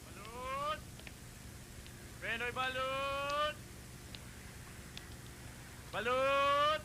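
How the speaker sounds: vendor calling out. Acted.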